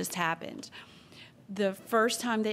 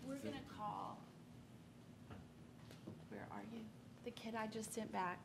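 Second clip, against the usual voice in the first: much softer, off-to-the-side murmur; hushed conferring; 2 semitones higher, 17 dB quieter, faster than usual. Real, not acted. Official arranging to call the kid back, hushed.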